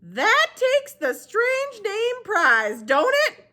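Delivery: put-on high, very slow old woman; Gloria Dump voice. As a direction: draw the name out slowly, high up.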